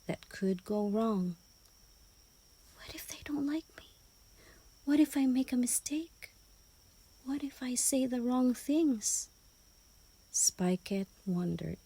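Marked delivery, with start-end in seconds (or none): 2.8-9.3 higher, fast anxious whisper; worried hedgehog thoughts. Acted. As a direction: fret under your breath like a scared little hedgehog.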